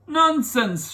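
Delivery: high, loud indignant shout; prince shouting. Acted. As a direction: bark it out like an offended prince. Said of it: "shouted the prince".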